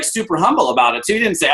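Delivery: ordinary talk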